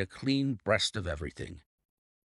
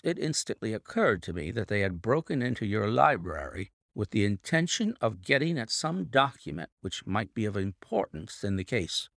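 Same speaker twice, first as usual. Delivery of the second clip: ordinary delivery, nothing marked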